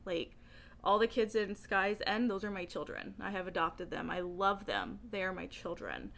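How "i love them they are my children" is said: ordinary delivery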